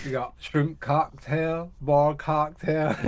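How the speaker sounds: slow, hammed-up Alabama drawl; mock Southern accent. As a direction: stretch out a bad Southern accent. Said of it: "terrible attempts at some accents".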